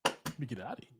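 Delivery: low muttered aside; a quiet, low mock getaway line; mock sneaking off. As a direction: duck out quietly like a man hiding. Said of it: "Rome's like".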